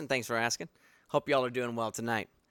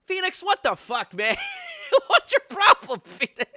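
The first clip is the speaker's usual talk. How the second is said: laughing exasperation; 5 dB louder than usual. Real, not acted. Laughing outburst at Phoenix.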